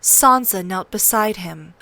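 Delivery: plain reading voice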